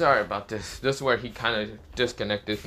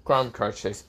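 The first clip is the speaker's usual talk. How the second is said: ordinary delivery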